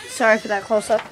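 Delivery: normal talking voice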